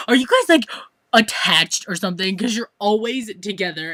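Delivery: loud, high, mock-amazed English question from the friend; friend's loud question. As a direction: call it out like a surprised friend. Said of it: "she's like".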